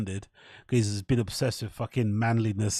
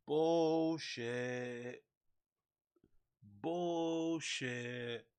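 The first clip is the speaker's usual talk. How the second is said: muttered from background; 15 dB quieter, much slower than usual. Retold or imitated. Friend muttering from the background, soft heckle. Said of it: "someone in the background going".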